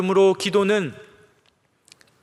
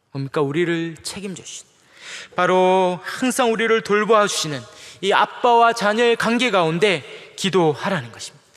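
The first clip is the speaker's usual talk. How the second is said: ordinary delivery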